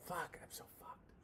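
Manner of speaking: breathy whispered curse under breath; whispered aside. Real, not acted. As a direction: mutter it to yourself in a whisper. Dejected aside after weed idea.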